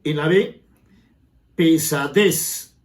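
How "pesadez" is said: "pesadez" slowly, with -dez punched; drawn-out "pesadez", voice pushed onto -dez; stress on last syllable. Shown as a demonstration. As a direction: lean on the end of "pesadez": pe-sa-DEZ. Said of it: "el impulso de voz".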